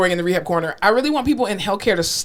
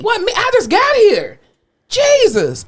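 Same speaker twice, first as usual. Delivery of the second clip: high, drawn-out exasperation; mock indignant protest; 13 semitones higher, 4 dB louder, slower than usual. Therapist blamed by grumpy patient, mock indignant. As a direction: protest your innocence shrilly.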